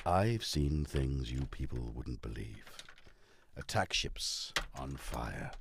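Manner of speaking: low, slow, hushed recital with long pauses; dramatic monologue. Acted. Speaker quotes a film speech mid-task, solemn low monologue. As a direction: deliver it like a solemn dying speech, low and drawn out.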